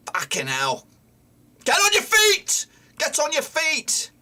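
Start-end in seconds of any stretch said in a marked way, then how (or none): 1.6-4.1 shout. Real, not acted. Fan yelling at a player on the ground. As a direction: bark him back up.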